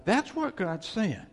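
ordinary talk, nothing marked

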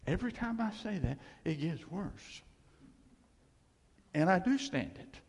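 ordinary delivery

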